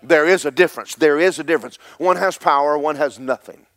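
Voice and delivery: preacher's rising proclamation; preaching shout. Acted. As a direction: hammer it home to the congregation.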